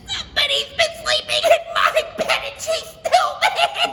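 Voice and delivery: very high, loud crying cub; wailing Baby Bear. Acted. Baby Bear crying over his empty bowl. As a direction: wail it through tears.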